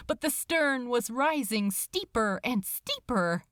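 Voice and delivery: ordinary delivery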